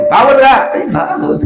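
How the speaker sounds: sharp shout. Retold or imitated. Teacher acts out storming in to wake a student, mock shout. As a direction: bark it at a sleeper you've just walked in on.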